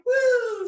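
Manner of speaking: high, loud whoop; playful woo. Pretend. Teacher swinging like a monkey, high loud whoop.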